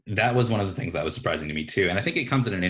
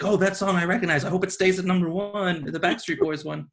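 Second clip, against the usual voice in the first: put-on kid voice; 11 semitones higher than usual. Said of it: "It was just like".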